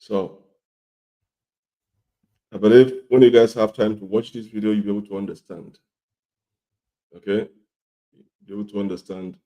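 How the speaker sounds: rough, coarse voice from a cold; talking through a sore throat; hoarse voice. Real, not acted. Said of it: "My voice is, um, coarse".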